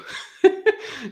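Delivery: high, loud incredulous laugh; burst of laughter. Real, not acted. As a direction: break into a laugh at the absurdity. Laughing at absurd phone story.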